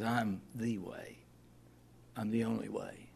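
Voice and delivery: slow, soft and firm; earnest correction. Retold or imitated. Preacher giving Jesus's true words, slow and sincere. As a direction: settle it quietly and surely. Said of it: "No, he says".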